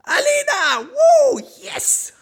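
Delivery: high wild whooping shout; rowdy party animal. Pretend. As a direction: scream the name like a crowd. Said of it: "ausgeschautet genug?".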